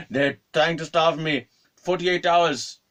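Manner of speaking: high, loud mock outrage; hammed-up old man's aggrieved cry; complaining old man. Old man protesting that he is starved, aggrieved.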